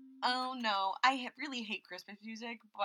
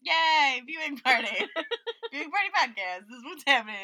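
loud laughing cheer; excited cheer; 5 semitones higher, 10 dB louder than usual. Real, not acted.

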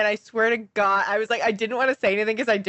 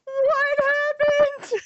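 very high, very slow stunned question; mock alarmed confusion; much slower than usual. Roommate stunned by the crying, a very high drawn-out question. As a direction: stretch it out in disbelief.

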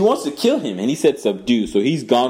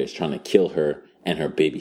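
ordinary delivery

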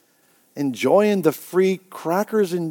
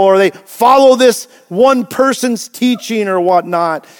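rising preacher voice, louder; preaching; 5 semitones higher, 4 dB louder than usual. Acted. Pastor to congregation, preaching harder. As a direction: build the sermon with growing force. Preacher building on self-salvation.

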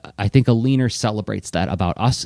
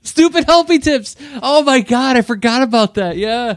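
excited; 14 semitones higher than usual. Real, not acted. Delighted recall of old name.

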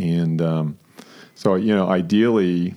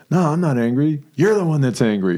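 higher, imitating an indignant denial; mock angry denial; 7 semitones higher than usual. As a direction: play the defensive angry person, lightly. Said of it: "it's like".

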